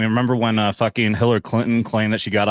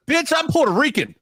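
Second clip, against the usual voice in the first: indignant; 13 semitones higher than usual. Real, not acted. Indignant punchline.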